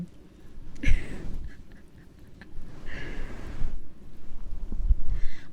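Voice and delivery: long, loud burst of laughter; real laugh. Real, not acted. Streamer laughing over her own old story, long loud laugh. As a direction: crack up laughing at length.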